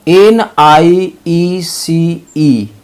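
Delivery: letters drawn out one by one, very slow; drawn-out letter spelling. Shown as a demonstration. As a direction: spell each letter slowly for the class. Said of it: "spelling out letters".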